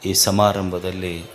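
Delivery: low, soft, devout salutation to the gathering; hushed formal greeting before a sermon; solemn invocation. Acted. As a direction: open the sermon quietly and reverently.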